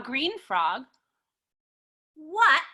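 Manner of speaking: usual speaking voice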